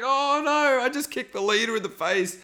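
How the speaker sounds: high alarmed oh no; a high panicked oh no; horrified realization. Retold or imitated. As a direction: panic in a high voice at what he did. Buddy realizing he kicked Jeff.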